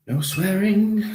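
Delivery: high, loud, drawn-out telling-off; mock scolding. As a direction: scold yourself playfully, pitched right up. Catches himself after saying crap.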